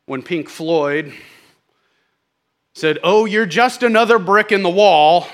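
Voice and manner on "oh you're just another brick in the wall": louder, higher dismissive jibe; imitating a scoffer's insult; mock insult. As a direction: toss it off as a put-down. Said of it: "they thought that was an insult".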